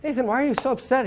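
breezy Italian asking why he's upset; playing the easygoing Italian. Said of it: "I'm Italian".

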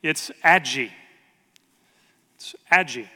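Aggie said as "ADJ-ee"; toddler's mispronounced name. Retold or imitated. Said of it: "her name is not Abigail".

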